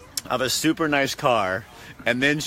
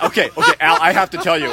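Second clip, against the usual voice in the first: laughing confession; 5 semitones higher, 8 dB louder than usual. Retold or imitated. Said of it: "And I went".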